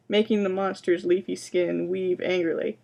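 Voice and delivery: usual speaking voice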